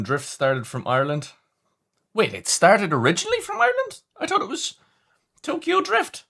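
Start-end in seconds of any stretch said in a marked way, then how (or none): 2.1-6.2 mock surprise.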